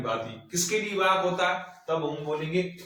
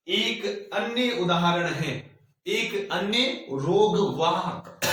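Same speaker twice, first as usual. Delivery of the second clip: dictating; 3 semitones higher, much slower than usual. Shown as a demonstration. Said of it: "लिखो".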